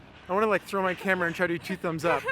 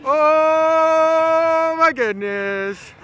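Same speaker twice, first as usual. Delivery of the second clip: slow, stretched, near crying with joy; drawn out in awe; overwhelmed excitement; 13 semitones higher, 9 dB louder, much slower than usual. Real, not acted. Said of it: "We're so pumped up!".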